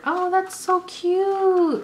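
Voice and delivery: high, slow, drawn-out coo; cooing gush. Real, not acted. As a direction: melt over how cute it is. Gushing over a bracelet.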